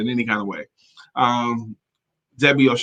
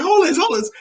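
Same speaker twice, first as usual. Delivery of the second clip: urgent request. Retold or imitated. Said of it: "I was like".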